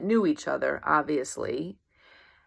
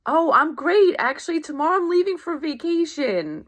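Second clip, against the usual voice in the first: high, louder happy news; excited; 9 semitones higher, 4 dB louder than usual. Retold or imitated. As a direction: gush about the trip. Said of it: "You may say".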